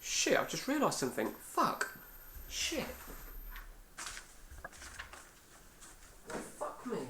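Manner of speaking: very soft cursing under the breath; muttered dismay. Real, not acted. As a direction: curse quietly to yourself on realising a mistake. Realises a mistake, curses to himself.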